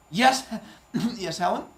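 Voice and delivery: caught-out husband laughing nervously. Acted. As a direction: answer with a flustered chuckle. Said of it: "You sound funny".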